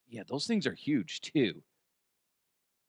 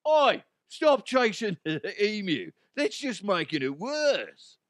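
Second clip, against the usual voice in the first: British officer; 8 semitones higher than usual. Retold or imitated. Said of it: "they're British".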